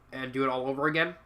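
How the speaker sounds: ordinary delivery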